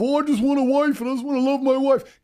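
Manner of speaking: high, dreamy lovestruck voice; mocking lovesick husband. Mocking Mister Banks as a lovesick husband, high and dreamy. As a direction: parody a man mooning over marriage. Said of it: "so in love with the idea of".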